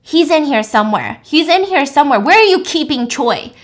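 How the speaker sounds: loud, frantic retold shouting; screaming. Will screams at Eden about where Choi is, frantic yelling. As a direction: yell it like you've lost all composure. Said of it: "he starts screaming at Eden".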